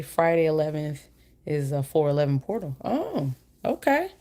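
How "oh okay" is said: sudden high "oh, okay"; surprised. Real, not acted. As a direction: caught off guard, pitch jumps up. Reacting to a viewer's news.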